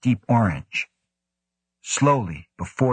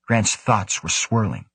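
usual speaking voice

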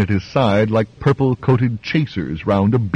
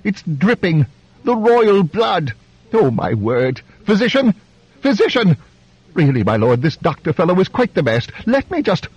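fussing high-voiced duke; 10 semitones higher than usual. The Duke fussing over the blood.

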